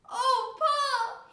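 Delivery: put-on high, loud terrified child's wail; shaking with fright. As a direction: cry out to your father in terror. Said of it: "his voice shook with fright".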